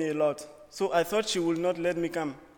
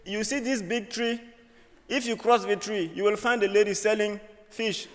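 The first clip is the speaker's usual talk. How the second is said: higher, lively telling of the directions back home; home-country directions; 5 semitones higher, 5 dB louder than usual. Retold or imitated. Explaining to the audience how people back home give directions, lively and higher. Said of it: "We say".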